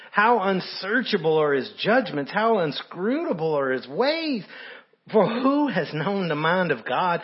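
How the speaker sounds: preaching scripture. Acted. Preacher reads Paul's praise to the congregation, fervent. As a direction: proclaim it, swelling to the glory.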